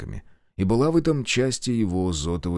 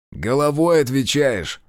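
slow, firm and louder; stern warning; 4 semitones higher, 4 dB louder, slower than usual. Acted. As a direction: lay down the warning. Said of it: "напомнил часовому капитан".

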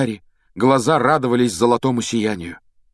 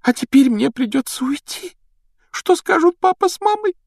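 put-on high-pitched lamenting girl; tearful; 3 dB louder than usual. Girl dreading telling her parents she must leave, tearful and high. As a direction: wail it plaintively, voice way up. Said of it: "плакала Джинни".

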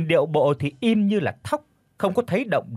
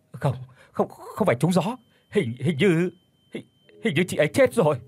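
horrified husband; 3 semitones higher than usual. Acted. Husband realises she is dead.

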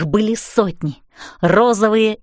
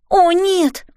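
exclaiming; 9 semitones higher than usual. A narrator's reading. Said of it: "воскликнула я".

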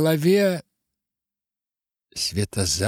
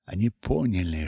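plain reading voice

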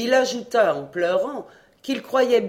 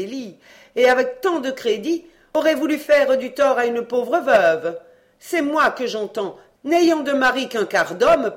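indignant mother; 6 semitones higher, 6 dB louder than usual. A narrator's reading. Mother voice, held figure.

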